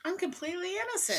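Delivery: mock innocent. Joking innocent claim.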